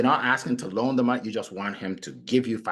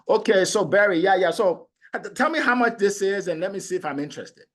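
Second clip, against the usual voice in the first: higher, brisk impatient prospect voice; pushy prospect; 8 semitones higher, 4 dB louder than usual. Retold or imitated. Salesman retells a prospect who asks for price. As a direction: rush the salesman, mildly mocking.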